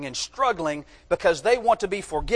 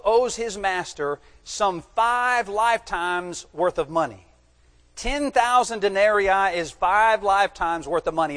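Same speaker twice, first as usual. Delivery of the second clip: ordinary delivery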